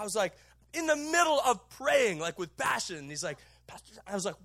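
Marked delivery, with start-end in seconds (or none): 3.7-4.0 hushed whisper of the name. Retold or imitated. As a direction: whisper it close to the ear. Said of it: "he whispers in my ear".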